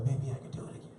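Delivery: whispered tempted musing. Retold or imitated. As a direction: whisper it to yourself. Tempted thought.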